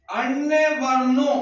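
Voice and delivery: slow, word by word, long pauses between phrases; slow dictation. Shown as a demonstration. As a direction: dictate it slowly so the class can write it down. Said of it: "वो लिखो".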